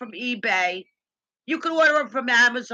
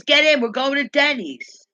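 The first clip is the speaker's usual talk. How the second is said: louder, higher mock order; retold bossy order; 4 semitones higher, 7 dB louder than usual. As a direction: call out the order as you retell it. Retold order to get in car.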